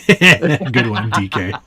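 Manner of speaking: ordinary talk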